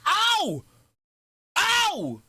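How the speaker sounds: two drawn-out, high-pitched whoops; high whooping "ow". Real, not acted. Streamer reacting to the spin, high excited whoops.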